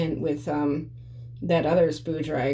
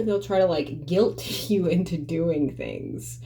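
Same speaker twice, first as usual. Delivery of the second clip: ordinary delivery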